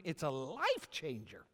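slow, excited stretch that cracks upward; excited squeak. Real, not acted. Preacher excited about knowing God, voice squeaking high. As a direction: voice jumps high and squeaky on "life". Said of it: "my voice just went really high and squeaky".

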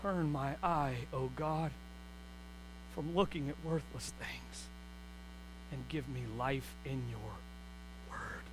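low, soft, slow prayer; hushed earnest prayer; quiet prayer. Acted. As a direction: pray it quietly to God. Prayer quoted.

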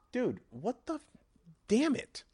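sudden high-pitched exasperation; annoyed outburst. Real, not acted. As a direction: snap at the barking dog. Dog barking annoys him.

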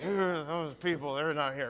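mock pew-owner sniffing at Christmas crowd; snooty churchgoer. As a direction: look down your nose at the latecomers. Snooty regular parishioner mocked.

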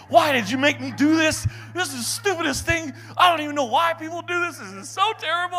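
high, loud mock rant; retold complaining. Cursing his buddy mid-marathon, high mock rant. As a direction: whine furiously at the friend.

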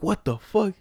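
retold yelling. Grandma demanding who took her money, mock yell. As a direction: bark it out like an angry grandma. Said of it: "she was yelling at everybody".

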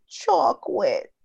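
put-on high, slow toddler voice, "chock-wit"; defeated little girl. Little girl answers her mom, defeated child voice. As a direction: imitate a small child giving up. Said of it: "she sounds, like, so defeated".

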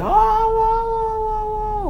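high, drawn-out alarmed whoa; startled whoas. Retold or imitated. Friends as the door bursts open, high startled whoas. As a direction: yelp in shock as the door bursts.

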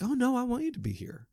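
high-pitched imitation of a small child; little boy. Young patient asking the visitors to stay, imitated little-boy voice. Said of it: "little boy, Southern accent".

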